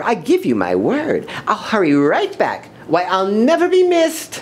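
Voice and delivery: high, airy bird voice, climbing at the end; breezy lazy bird. Acted. Lazy bird promises to hurry back, high and breezy. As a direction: promise lightly, floating up high.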